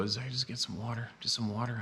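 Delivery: soft, timid request; polite, timid. Retold or imitated. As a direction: imitate a meek, polite asker. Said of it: "asking in a real polite, timid voice".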